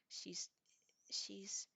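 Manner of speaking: halting, with a gap before repeating; hesitant, struggling. A narrator's reading. Roommate unsure what she should say about the bully, hesitant. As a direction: search for how much to say, trailing off. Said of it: "Stevie Ray was struggling with how much she should or shouldn't say".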